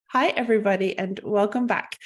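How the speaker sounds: usual speaking voice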